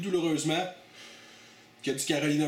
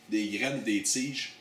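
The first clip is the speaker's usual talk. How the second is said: ordinary talk, nothing marked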